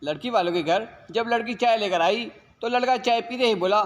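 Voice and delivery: usual speaking voice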